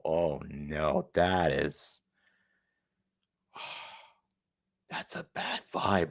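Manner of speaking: soft, sighing fake-scared whisper; mock dread whisper. Mocking the traveller's fear of the flag, a hushed fake dread. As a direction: act spooked, half-whispered.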